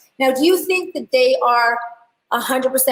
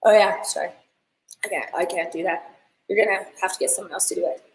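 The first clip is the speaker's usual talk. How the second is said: lower, softer, a touch of an awkward brush-off; awkward refusal; 7 semitones lower, 5 dB quieter than usual. Retold or imitated. Stylist turning down her client's wedding, mildly awkward and low. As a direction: mildly back out of the job, dropping low. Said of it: "Amanda's like".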